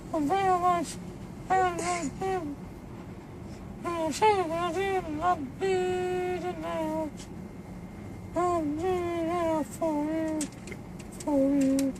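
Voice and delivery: high falsetto wailing a mock love song. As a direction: sing it in a strained high falsetto. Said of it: "How can you be talking like that?".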